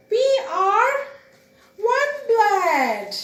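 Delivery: lightly voiced monkeys. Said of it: "The monkey says".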